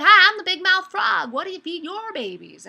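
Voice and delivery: high, loud big-mouthed frog greeting. Acted. Frog greets a bird, big-mouthed and booming. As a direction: belt it out with a wide-open cartoon mouth. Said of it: "he speaks with a really big mouth".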